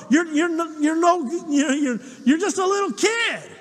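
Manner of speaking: a mock furious big brother dressing him down; angry older brother. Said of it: "his brother gets angry at him".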